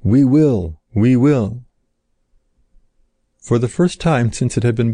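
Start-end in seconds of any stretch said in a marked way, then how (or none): 0.0-0.8 eager first promise of We will. A narrator's reading. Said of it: "answered the more eagerly". 0.9-1.6 echoed We will, eager, slightly changed voice; eager repeated answer we will. A narrator's reading. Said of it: "answered the more eagerly".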